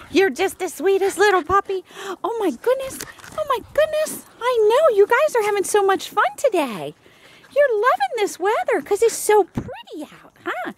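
high, sing-song cooing at a dog; baby talk to a puppy. Acted. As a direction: gush over the sweet puppy. Cooing at puppies.

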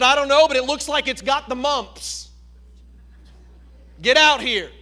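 loud, high furious demand; livid homeowner. Acted. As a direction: bark it like an outraged homeowner. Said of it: "And boy, he was livid".

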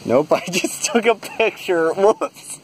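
laughing through the words; chuckling at a silly mistake. Real, not acted. Laughs at own camera mistake.

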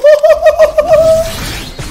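shrieking high laugh; loud high laughing fit; high burst of laughter. Real, not acted. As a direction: let out a piercing cackle. Laughing after play.

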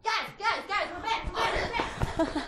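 high, loud excited yell; yelled on request. Pretend. Yelling we're back as asked to the crew. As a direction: burst in calling out to everyone. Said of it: "can you yell".

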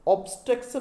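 "ऑब्स्ट्रक्शन" with a full "aw" first vowel; students' mispronunciation. Retold or imitated. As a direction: imitate students saying "ऑब्स्ट्रक्शन" as "awb-STREK-shun". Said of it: "बहुत सारे विद्यार्थी इसको". ("ऑब्स्ट्रक्शन" छात्रों की तरह, खुले "ऑ" से)

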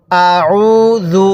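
আউযু chanted very slowly; slow recitation. Shown as a demonstration. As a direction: draw out আউযু, no stretch on the হামজা. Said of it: "কিভাবে শিখব". (আউযু খুব ধীরে তিলাওয়াত)

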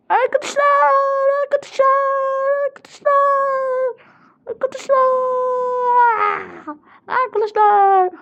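high, wailed calls, one by one; play-acted desperate calling. Player calling for missing friends, high desperate cry. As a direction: call out high and forlorn.

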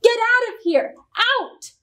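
high-pitched outraged tirade; crying out angrily. A narrator's reading. Witch doctor defending his life's work, crying out angrily. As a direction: rant indignantly, pitch climbing on the order. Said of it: "cried Orestiel".

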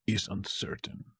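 ordinary delivery

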